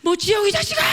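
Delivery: high, loud anguished yell; wailing outburst. Retold or imitated. Enraged student hearing the word hell, acted tearful shout. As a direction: burst out shouting, as if sobbing. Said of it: "펑펑 울면서".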